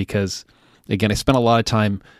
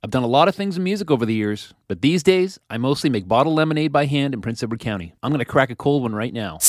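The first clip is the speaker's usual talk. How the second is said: bright, upbeat higher announcer read; chipper 1950s ad; 4 semitones higher than usual. Acted. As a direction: sell it like a cheerful vintage radio spot. Sponsor ad read at show's end.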